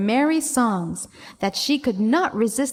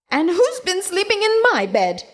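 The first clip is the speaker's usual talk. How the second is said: much higher mock scream; a hammed-up mother bear shriek; 9 semitones higher, 3 dB louder than usual. A narrator's reading. Mother Bear screams about her bed, high. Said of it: "screamed Mother Bear".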